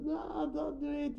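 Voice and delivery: mock-high plea to stop; a hint of Draupadi begging them to stop; pleading woman. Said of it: "And Draupadi goes".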